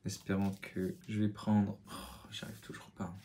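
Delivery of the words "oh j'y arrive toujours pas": much softer, whispered frustration; frustrated whisper. Real, not acted. Muttering to himself at the mirror, whispered. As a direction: breathe it out under your breath. Struggling with the makeup.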